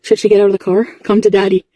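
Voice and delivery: ordinary talk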